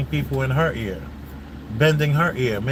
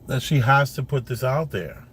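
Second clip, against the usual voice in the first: usual speaking voice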